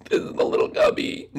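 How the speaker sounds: whispery little character. Pretend. Gil shows off his little cubby, high whispery voice. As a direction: stretch it out in a high, airy whisper.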